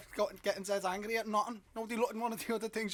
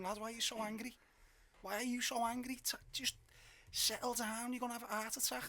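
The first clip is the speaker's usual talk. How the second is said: softer, higher placating voice; mock-calm reasoning; 5 semitones higher, 7 dB quieter than usual. Fan telling angry lad to settle down, mock-calm. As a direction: gently talk an angry man down, retold. Retold calming of an angry man.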